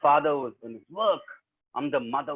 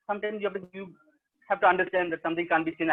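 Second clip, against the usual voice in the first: usual speaking voice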